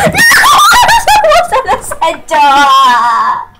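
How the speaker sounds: high, loud wail, drawn out at the end; gamer outburst. Real, not acted. Player losing a game, loud high outburst. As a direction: cry out in game frustration.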